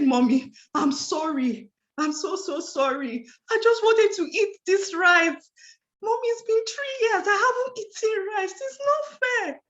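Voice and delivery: high, pleading, near tears, pitch climbing; tearful pleading child. Retold or imitated. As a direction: beg your mom's forgiveness like a crying little girl. Child begging mother after caught.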